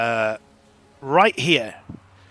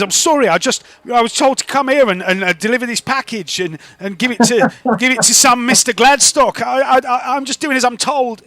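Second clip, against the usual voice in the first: higher, flustered, stumbling excuses; apologetic delivery driver; 7 semitones higher, 4 dB louder than usual. Acted. Intimidated driver explaining his errand, apologetic. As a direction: plead that you only follow orders.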